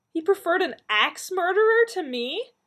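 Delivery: high, loud, incredulous outburst; outraged disbelief. A narrator's reading. Donna learning Lance chose a murderer, high loud outrage. Outraged at being passed over.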